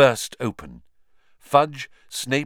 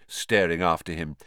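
ordinary delivery, nothing marked

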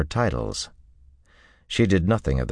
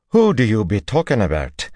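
high, demanding Teslin; sharp, high-pitched demand; 7 semitones higher, 5 dB louder than usual. A narrator's reading. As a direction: demand an answer in a raised, accented voice. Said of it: "Teslin demanded".

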